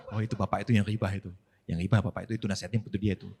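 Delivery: low, soft, fast murmur to a neighbour; gossiping murmur. Retold or imitated. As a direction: gossip under your breath. Jamaah bergunjing pelan tentang orang lain. (jamaah berbisik menggunjing tetangga, pelan)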